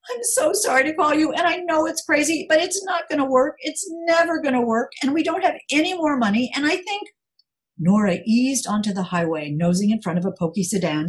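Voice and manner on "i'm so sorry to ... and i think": put-on much higher, rushed, desperate young woman; a panicked friend babbling apologies; distraught Caitlin. Caitlin voiced, distraught on phone.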